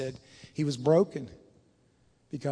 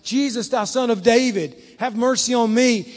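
raised, preached cry for mercy; louder calling out; 6 semitones higher, 7 dB louder than usual. Acted. Blind Bartimaeus calling to Jesus, calling out louder. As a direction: call out over the crowd, pitched up. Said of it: "he got the louder and louder".

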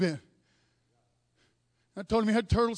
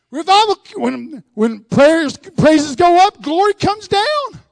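slow, loud building proclamation; preaching climax; 3 semitones higher, 11 dB louder, slower than usual. Acted. Preacher building to the revival climax, slow and loud. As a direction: build it up and let it ring.

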